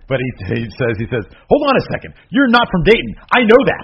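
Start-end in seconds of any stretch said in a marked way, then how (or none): 1.5-3.8 high, loud imitation of an indignant wrestler; retold loud challenge. Co-host quoting a wrestler challenging his opponent, loud indignant imitation. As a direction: call it out high and emphatic. Acting out Owens' challenge quote.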